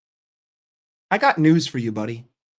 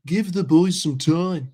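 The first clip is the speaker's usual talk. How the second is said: mock British accent. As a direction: sneer it like a smug Brit. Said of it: "stupid ass fucking [name] accent".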